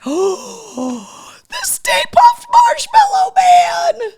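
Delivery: gasp, then shout it very high, loud and slow; thrilled shout. Real, not acted. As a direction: scream it out in sheer delight. Opening a thrilling gift.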